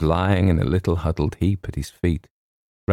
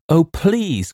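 put-on high-pitched girlish plea; pleading cry; 12 semitones higher, 4 dB louder than usual. A narrator's reading. Girl begging for help with a dog, high pleading cry. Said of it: "cried the girl".

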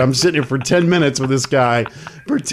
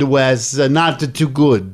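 usual speaking voice

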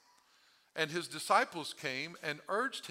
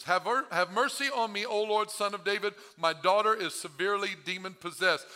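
loud, raised plea of a mother; crying out; 5 semitones higher, 7 dB louder than usual. Acted. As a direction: plead urgently at high volume. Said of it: "you're crying out to the Lord".

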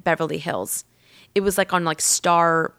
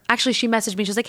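ordinary talk

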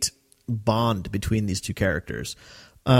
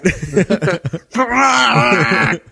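loud, high hammed-up creature howl; comic roaring howl with laughter; imitated Wookiee roar; 10 semitones higher, 6 dB louder than usual. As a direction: let out a long mock Wookiee wail. Imitates Chewie's roar in the scene.

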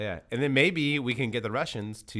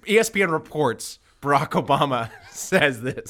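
half-laughing mock headline, raised a little; mock news report, laughing; 5 semitones higher, 5 dB louder than usual. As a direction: read it like a fake news report while cracking up. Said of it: "then we say".

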